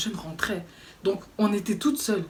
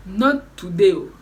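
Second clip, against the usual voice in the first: "Not today" again, louder and in a changed, hammed-up voice; louder mock refusal; 8 dB louder, slower than usual. Repeats refusal more dramatically.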